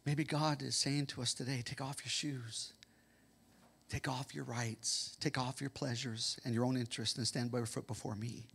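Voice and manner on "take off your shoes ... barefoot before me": soft voice of God. Pretend. God quietly asking his people to stand barefoot. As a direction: speak it gently as God. God's voice quoted softly.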